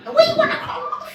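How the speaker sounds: put-on high, chanting kid voice begging for a toy; whiny kids. Children pestering dad for a toy crocodile, high whiny chant.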